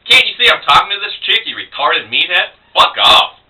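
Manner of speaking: acted belligerent outburst; angry retort. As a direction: lash out at an interrupter. Insulting man who interrupted him.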